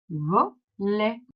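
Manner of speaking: "Volet" in two beats, vo... let; split into syllables. Shown as a demonstration. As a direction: pause between the syllables of "Volet". Teacher splits word into syllables.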